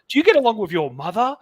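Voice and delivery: high, loud mock interviewer question. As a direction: send up her prying question with a lifted voice. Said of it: "And she's like".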